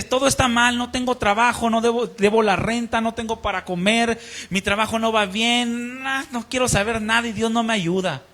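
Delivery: más alto y fuerte, quejándose de todo; queja airada. Retold or imitated. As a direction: desahogo enojado de un hombre desesperado. (el muchacho se queja a gritos de su vida)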